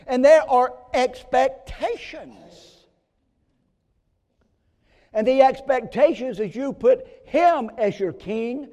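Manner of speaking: slow, loud, high-pitched declaration; thundering pulpit voice; preaching at full force. Acted. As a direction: hammer home the expectations.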